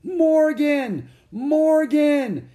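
very high, loud calling yell; piercing repeated cry. A narrator's reading. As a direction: yell the name to snap someone out of it. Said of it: "Annie yelled".

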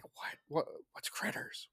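whispered confusion; puzzled whisper. Retold or imitated. Wife whispering back in the theater, puzzled. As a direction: she whispers back, lost.

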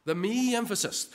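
high, lingering weight on "me"; stress on ME. Shown as a demonstration. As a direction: punch "me" high, then drop away. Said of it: "Do you hear the emphasis?".

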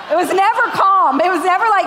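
amused outburst; giggling while telling; laughing. Real, not acted. Laughing about cheesy shows.

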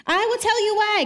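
high, loud and emphatic, hitting "tell" hard; God thundering back at the people; booming divine retort. Acted. Pastor reading God's answer to the complainers, loud and emphatic. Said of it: "God responds".

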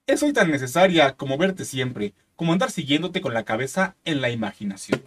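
slow, solemn reading of lyrics; dramatic lyric reading; poem recital. Acted.

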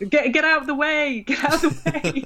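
high shouted warning, hammed up; mock urgent yell. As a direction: yell it like a panicked henchman. Reenacting the henchman scene.

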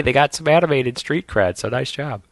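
ordinary delivery

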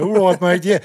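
loud, high put-on Australian greeting; mock Australian accent. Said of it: "pull on an Australian accent".

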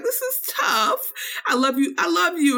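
high, crying mock sob; tearful goodbye. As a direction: wail it like a hammed-up heartbroken girl. Retelling New York's tearful goodbye.

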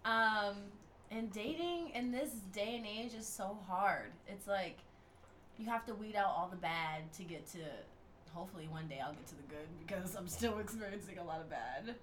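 ordinary delivery

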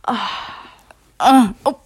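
while brushing teeth. Shown as a demonstration. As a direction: gargly sound of brushing teeth. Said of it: "That's what I sound like when I'm brushing my teeth".

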